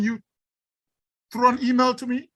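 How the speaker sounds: normal talking voice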